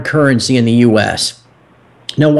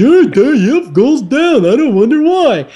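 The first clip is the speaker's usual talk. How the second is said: higher, airheaded TV pundit voice; mocking TV pundit; 9 semitones higher than usual. Host mocks CNBC pundits to his guest, airheaded pundit voice. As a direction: play a clueless cable-news talking head. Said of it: "goons and bubbleheads on C N B C".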